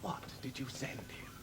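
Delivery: hushed, dangerous question; very soft, controlled menace; menacing whisper. Acted. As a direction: whisper it as a threat. Menacing question after her admission.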